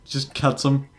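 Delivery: ordinary talk, nothing marked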